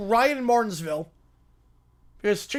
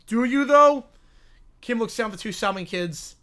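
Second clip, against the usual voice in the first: ordinary talk, nothing marked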